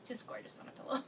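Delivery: Just gorgeous on a pillow breathed softly, unvoiced; dreamy whisper. Real, not acted. Dreamy repeat of the idea.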